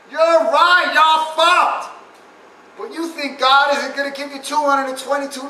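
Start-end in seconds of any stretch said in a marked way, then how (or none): 0.1-1.9 louder, higher gloating; taunting. Acted. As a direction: rub it in their faces. God character taunting audience.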